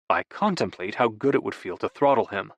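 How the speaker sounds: usual speaking voice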